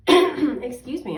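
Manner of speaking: a firmer second excuse me; throat-clearing excuse me. Real, not acted.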